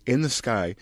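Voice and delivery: ordinary delivery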